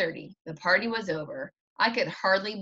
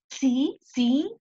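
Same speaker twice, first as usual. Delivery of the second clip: usual speaking voice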